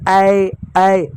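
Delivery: ऐ opened wide and held; teacher drilling ऐ; vowel demo. Shown as a demonstration. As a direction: stretch ऐ clearly twice. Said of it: "ऐ बोलूँगा तो दो डंडा".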